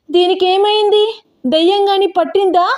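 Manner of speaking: high-pitched, scared questioning; frightened. A narrator's reading. Abhi asks if Vidya is possessed, frightened. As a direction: cry out in alarm, voice jumping high. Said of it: "అంది భయపడుతూ".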